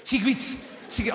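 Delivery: put-on high South African accent, vowels vanishing. Comic mimicking South Africans saying cigarettes, South African accent. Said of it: "it sounds like secrets".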